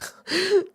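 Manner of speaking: quick, high laugh. Real, not acted. Laughing at walking a spider like a dog, high laugh. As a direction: laugh at the image.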